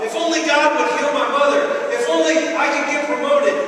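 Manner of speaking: loud, high complaint. Acted. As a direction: moan your regrets at God.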